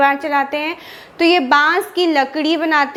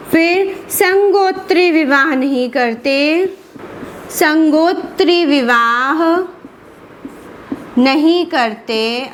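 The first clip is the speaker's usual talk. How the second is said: slow emphatic dictation; slower than usual. Shown as a demonstration. Teacher stresses key term for notes.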